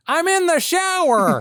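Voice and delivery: very high, strained nasal shout; hammy nasal shouting impression; David Lynch. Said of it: "Number three is just David Lynch".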